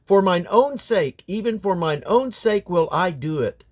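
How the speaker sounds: usual speaking voice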